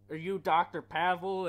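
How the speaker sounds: loud, nasal-free but pushed-up agent voice; annoying CIA guy voice. Retold or imitated. Friend recites CIA agent, loud and high. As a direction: bark it like an irritating CIA agent. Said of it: "the C I A guy has the most annoying fucking voice ever".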